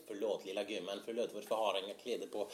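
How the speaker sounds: speaking Swedish, fast at the end; Swedish accent. Shown as a demonstration. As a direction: rattle off in Swedish, lightly. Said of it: "Swedish sounds like this".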